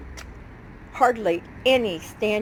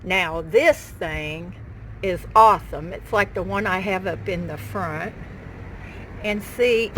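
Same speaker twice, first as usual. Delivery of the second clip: usual speaking voice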